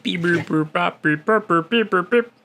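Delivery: beep-boops climbing to a high squeal; robot beeping. Retold or imitated. Copying the toy robot beeps.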